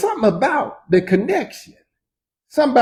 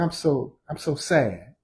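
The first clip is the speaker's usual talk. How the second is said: quiet sad; 5 semitones lower, 10 dB quieter, slower than usual. Retold or imitated. Retelling grief after father died.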